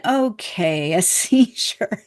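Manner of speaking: slow, laughing disbelief at the word seizure; half-laugh as it sinks in; a seizure, said slowly through a startled laugh; stunned inner thought. Retold or imitated. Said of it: "And I'm thinking".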